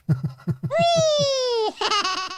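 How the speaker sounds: high-pitched giggling burst; high laugh. Real, not acted. Laughs after acting.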